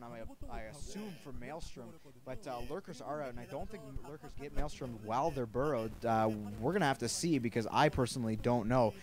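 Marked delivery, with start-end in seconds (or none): 0.0-5.9 much softer, almost under breath; quiet aside. Real, not acted. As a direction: drop to a hushed aside.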